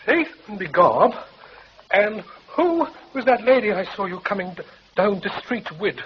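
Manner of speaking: high, comic stage Irish voice; music-hall Irishman, hammed up; stage Irish accent. Comic Irish act feed line.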